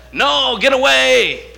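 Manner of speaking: high, loud and slow brush-off; annoyed refusal. Retold or imitated. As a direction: shout the neighbor off. Neighbor refusing at the door.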